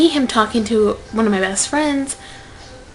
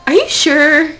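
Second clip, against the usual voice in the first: higher, louder incredulous question; retold disbelief; 6 semitones higher, 7 dB louder than usual. Asking her friend about the compliment, incredulous. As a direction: re-enact being floored and doubting it.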